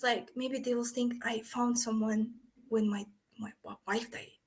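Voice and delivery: embarrassed whispered confession. Retold or imitated. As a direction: whisper it like a secret. Old man confides a worry.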